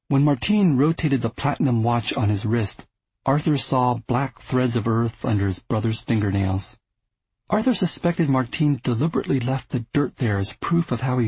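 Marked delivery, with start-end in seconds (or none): none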